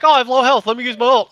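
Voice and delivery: hammed-up teammate impression; mocking Junkrat. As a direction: ham up a clueless player's excuse. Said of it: "He's like".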